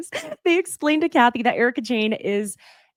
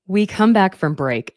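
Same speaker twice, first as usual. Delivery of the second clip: ordinary talk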